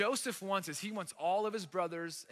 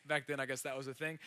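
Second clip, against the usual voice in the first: ordinary talk, nothing marked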